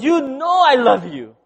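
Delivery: high, loud, slow avowal; insistent declaration. Retold or imitated. Peter answering Jesus's third question, emphatic insistence. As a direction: stretch it out, insisting with feeling.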